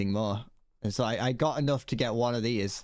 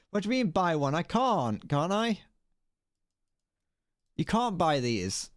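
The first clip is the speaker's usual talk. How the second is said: ordinary talk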